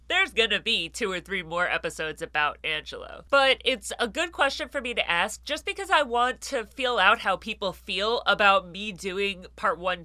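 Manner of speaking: normal talking voice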